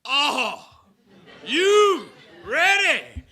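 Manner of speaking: slow, high, drawn-out shout; call to the crowd. Shown as a demonstration. Speaker showing the audience the call, slow and high. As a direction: lead the crowd into the chant. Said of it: "I'll yell".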